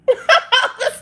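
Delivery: a burst of high laughter at her own mix-up; sudden high laugh. Real, not acted. Laughing at mixing up the dog's and her own name. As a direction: break into a sharp high laugh.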